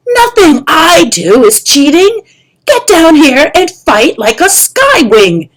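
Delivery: loud, high angry shout; furious shout. Acted. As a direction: order him down to fight. Kestrel furious at the pupil.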